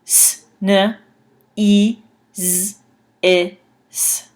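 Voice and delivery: S-N-E-Z-I-S letter by letter; spelled out by sound. Shown as a demonstration. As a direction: spell S-N-E-Z-I-S out slowly. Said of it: "it sounds like".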